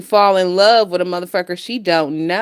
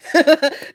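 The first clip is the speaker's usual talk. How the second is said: short laugh; 6 semitones higher than usual. Real, not acted. Laughs at own teen heartbreak.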